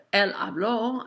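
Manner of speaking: "él habló" leaning on the final LÓ; stress on final syllable. Shown as a demonstration. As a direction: stress the end of "habló" after "él". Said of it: "emphasize that final syllable".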